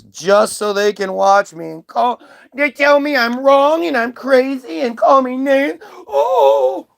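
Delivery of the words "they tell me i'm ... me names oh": high, mock-wounded whine about being insulted; exaggerated poor-me voice, rising to a high wail; mock whining. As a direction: whimper it like a hammed-up victim. Mocking his upset online critics.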